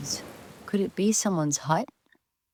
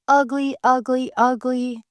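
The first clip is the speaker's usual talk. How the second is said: higher, louder chant of ugly ugly; taunting quack; 6 semitones higher, 4 dB louder than usual. A narrator's reading. Ducklings mocking the newcomer in a raised sing-song taunt. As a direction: jeer it like a playground taunt. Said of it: "the other ducklings quacked".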